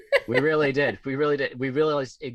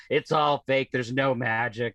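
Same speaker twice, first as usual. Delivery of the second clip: put-on jaded kid; slower than usual. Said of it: "jaded six-year-old who's like".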